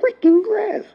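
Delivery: very high-pitched mock voice, drawn out; mocking quoted opponent. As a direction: mimic him in a squeaky, exaggerated plea. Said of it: "you come out here on your Stan Lee talking about".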